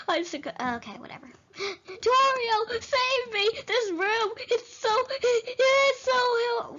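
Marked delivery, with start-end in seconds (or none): 1.6-6.7 high, whimpering cries for rescue; hammed-up damsel wail, pitched way up; mock screaming panic. Player calls Toriel to save her, mock panic. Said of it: "I've been screaming for a little while".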